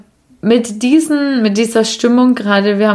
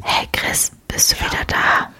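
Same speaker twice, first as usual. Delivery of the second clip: hushed whisper; 5 dB quieter than usual. Pretend. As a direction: breathe the words without voice. Whispered skit after break.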